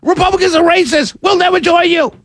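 mock yelling. Host mimicking critics yelling at him, mild mock yell. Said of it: "just yell and scream".

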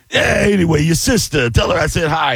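high, hollered mock stage patter; Italian guy impression. Comic mimics an Italian guy working the crowd, high holler. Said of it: "and then he'd go".

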